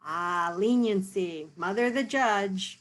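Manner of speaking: usual speaking voice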